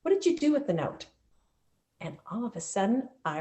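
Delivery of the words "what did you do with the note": higher, questioning principal voice; lightly voiced school principal; principal's stern question.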